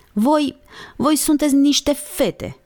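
put-on boy insults his sister and her friend indignantly; choked with indignation. A narrator's reading. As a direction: blurt it out choked with outrage. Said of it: "cu glasul înnecat de indignare".

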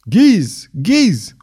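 cheering shout of a name; crowd cheering. A narrator's reading. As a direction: hail him like a roaring crowd. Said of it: "urla poporul cu aclamații".

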